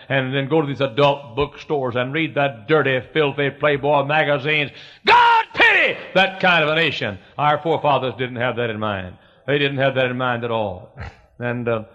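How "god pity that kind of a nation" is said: high shouted opening, easing toward the end; fervent denunciation. Real, not acted. Preacher decrying filthy bookstores, shouting in fervor. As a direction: cry out hard on the first words. Preacher denouncing the nation's sins.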